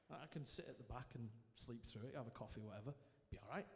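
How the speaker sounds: low, soft self-talk; reluctant mumbled agreement. Retold or imitated. Hungover man reluctantly agreeing to church, half-hearted. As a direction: talk yourself into it, low and quiet.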